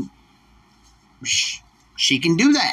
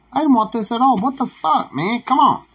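whiny protest; 9 semitones higher than usual. Retold or imitated. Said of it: "that asshole that sits back and be like".

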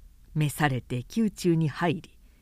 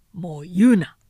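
louder, cutting off; sharp command; 2 semitones lower, 6 dB louder than usual. A narrator's reading. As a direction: shut him up firmly. 曹操 silencing advisor. (強く遮る)

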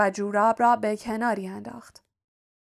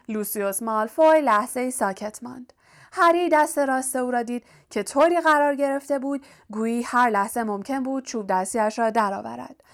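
ordinary delivery

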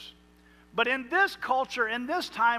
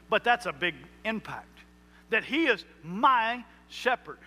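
ordinary talk, nothing marked

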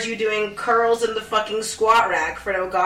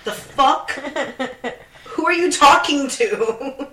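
getting angry; 5 semitones higher, slower than usual. Real, not acted. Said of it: "Sorry, I'm getting angry".

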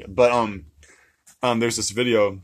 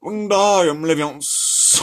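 slow, drawn-out, louder spell; exaggerated higher wizard incantation; parody spell-casting; 5 semitones higher, 5 dB louder, much slower than usual. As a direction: stretch the last syllable out loudly. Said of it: "I can do a pretty good one of those".

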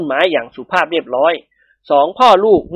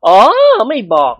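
ordinary delivery

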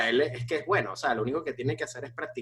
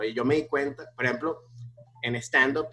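normal talking voice

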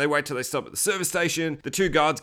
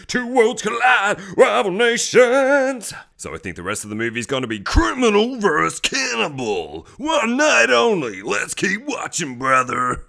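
trailer announcer; 6 semitones higher, 5 dB louder than usual. Pretend. Hyping film like a trailer.

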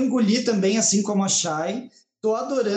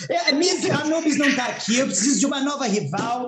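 briga de brincadeira; 7 semitones higher than usual. Pretend. Briga encenada com nova rival.

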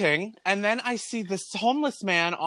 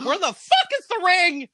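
high, loud exasperated yell; hammed-up furious question; retold outburst; 12 semitones higher, 6 dB louder than usual. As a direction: re-enact yelling in disbelief. Retold outburst at empty box.

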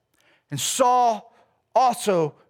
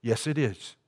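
much lower, softer solemn reply; deep answering voice; 11 semitones lower, 8 dB quieter than usual. Acted. Preacher voicing the lot's answer, deep and low. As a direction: drop low to give the verdict.

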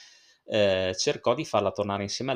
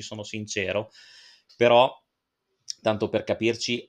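ordinary talk, nothing marked

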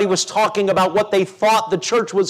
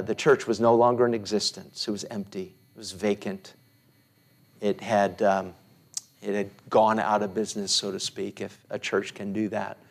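hushed sorrow; 7 semitones lower, 8 dB quieter than usual. Real, not acted. Sad account of the closed church.

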